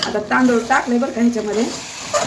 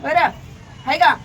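teasing pet talk; 6 semitones higher than usual. Real, not acted. Teasing the rooster.

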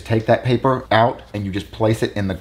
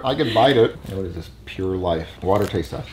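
ordinary delivery

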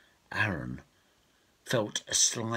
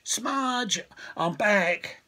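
calling out higher; 14 semitones higher, 4 dB louder, much slower than usual. Acted. Prince calling again for his pony, higher and louder.

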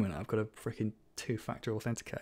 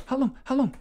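high, loud disbelief; incredulous; 7 dB louder than usual. Real, not acted. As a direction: repeat it higher in shock. Repeated higher and louder.

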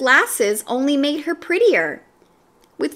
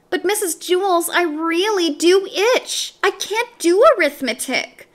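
whining; 8 semitones higher than usual. A narrator's reading. Dana whines to her teacher that she really itches. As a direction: beg to get out of arithmetic. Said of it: "Dana whined".

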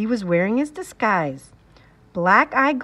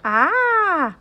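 put-on baby squeal; 7 semitones higher than usual. A narrator's reading. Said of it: "Tootsie said".

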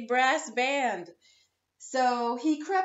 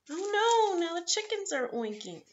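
dismayed cry; 7 semitones higher than usual. Acted. Dismayed farm animal voice.

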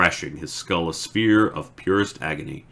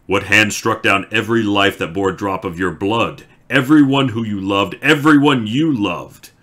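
a much louder, higher, rising shout; furious accusation; 4 semitones higher, 9 dB louder than usual. Acted. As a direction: throw the accusation at him full force. The betrayer taunts Lews Therin.

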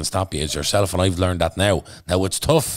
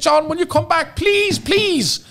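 very high pleading mock teacher voice; imitating the teacher; 4 dB louder, much slower than usual. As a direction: beg him to come back in a squeaky, much higher voice. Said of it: "And she said".

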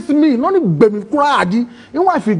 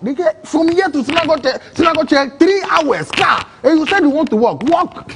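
incredulous driver; 7 semitones higher than usual. Retold or imitated. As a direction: scoff at the idea of walking. Driver mocks his plan to walk.

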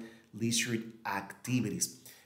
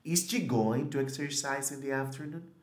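"Is she going to exercise in the afternoon?" with a rising end; question intonation; 4 semitones higher than usual. Shown as a demonstration. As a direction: lift the tune like a real question. (entonación de pregunta ascendente)

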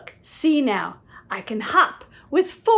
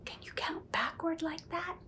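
soft breathy aside; whispered question; 20 dB quieter, faster than usual. Real, not acted. Parent asking the child in a whisper. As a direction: lean in and ask quietly.

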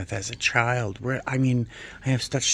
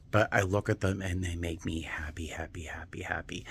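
happy whisper, fading; 3 semitones lower, 6 dB quieter than usual. Real, not acted. Whispering about pottery joy.

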